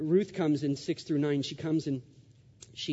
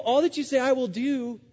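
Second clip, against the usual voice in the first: mock devoted child; 10 semitones higher, 5 dB louder than usual. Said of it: "She's dependable".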